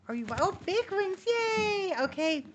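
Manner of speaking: delighted pet cheer. Real, not acted. Cheering her cockatiel spreading his wings, high thrilled squeal. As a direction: cheer him on like a proud parent.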